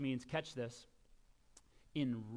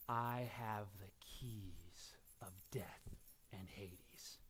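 solemn scripture; 7 semitones lower, 8 dB quieter than usual. Acted. Solemn reading of Jesus' words.

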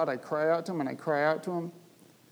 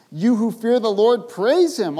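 high, loud call to praise; rally the congregation; preacher calling the assembly to praise; loud proclamation; 6 semitones higher, 9 dB louder than usual. Acted.

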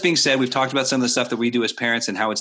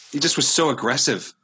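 ordinary talk, nothing marked

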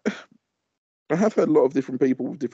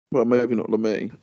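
ordinary delivery

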